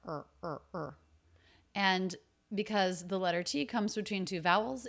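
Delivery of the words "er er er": er as a short, reduced "are"; lower, softer er, just schwa plus R. Shown as a demonstration. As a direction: say er as an unstressed murmur of are. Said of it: "It's just schwa R".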